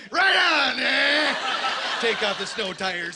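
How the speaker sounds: loud, high rowdy Canadian shout; hammed-up wild Canadian tourist; Canadian yahoo. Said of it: "crazy son of a bitch from Sudbury".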